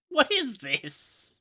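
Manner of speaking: high, slow, stretched-out disbelief; surprised. Real, not acted. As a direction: drag out the question in amazement. Reacting to surprising game offer.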